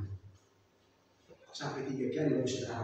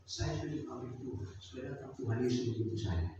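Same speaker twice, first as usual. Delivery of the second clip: quiet dying voice; 8 dB quieter than usual. Acted. Dying pastor tells his family.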